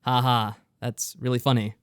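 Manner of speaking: ordinary delivery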